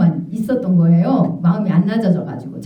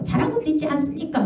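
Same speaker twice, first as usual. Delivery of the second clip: arguing back; 8 semitones higher, 5 dB quieter than usual. Retold or imitated. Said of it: "하고 막 따진 거예요".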